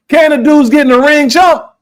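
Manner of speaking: high, loud taunt; heated challenge. Real, not acted. Host taunting boxers on the show, high loud shout.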